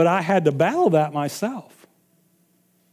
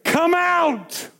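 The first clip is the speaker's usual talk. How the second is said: higher, sharp command to the demon; commanding rebuke; 10 semitones higher, 3 dB quieter, much slower than usual. Retold or imitated. Preacher showing a modern rebuke of a demon, sharp and commanding. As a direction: order it out at once. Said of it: "a modern day translation would be".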